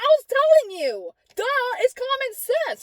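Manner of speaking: very high, emphatic I-told-you-so; shrieky retold scolding; exasperated retort. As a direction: drag out the obvious, exasperated.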